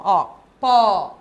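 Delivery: PÓ with a wide-open Ó, like "paw". Shown as a demonstration. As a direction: open up the vowel in PÓ. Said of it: "O ó foi aberto".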